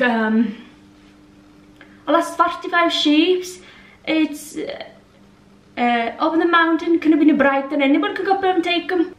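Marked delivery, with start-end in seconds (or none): 2.1-9.1 higher, sing-song mock Irish accent; amateur Irish farmer accent; Irish farmer impression. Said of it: "the farmer goes something like".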